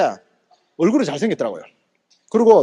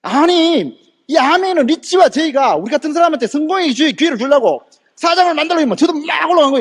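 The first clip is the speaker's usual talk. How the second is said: higher, heated protest; indignant rebuttal, pitched up; flaring anger; 6 semitones higher than usual. Retold or imitated. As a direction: argue back hotly, re-enacting a flare of temper. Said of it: "제가 화가 확 나는 거예요".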